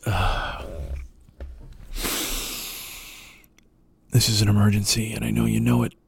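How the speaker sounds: low, hushed, solemn and grave; mock-serious emergency broadcast, breathy and low; Michael Moore impression. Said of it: "I'm even doing it with more passion than he did".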